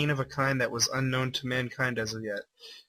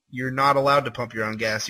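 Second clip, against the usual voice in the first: ordinary talk, nothing marked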